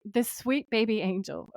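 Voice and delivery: ordinary talk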